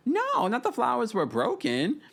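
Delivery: retold incredulous outburst; mock disbelief. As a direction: repeat it way up high, as if scandalised. Mock reaction to retold remark.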